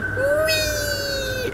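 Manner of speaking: very high, drawn-out "wee"; imitated squeal. Recalling what last time was like, imitated squeal. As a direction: squeal it far above the normal voice. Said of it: "Last time it was like".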